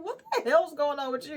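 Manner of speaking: lightly voiced mentor asking what's wrong; understated concerned mom; spiritual mom. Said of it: "She was like".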